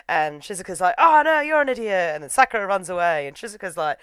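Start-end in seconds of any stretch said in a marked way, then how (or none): 1.0-2.1 high, loud comic comeback; mock indignation. Shizuka retorting in a silly quarrel, mock indignant. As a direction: throw it back, loudly indignant. Acted quarrel, insult thrown back.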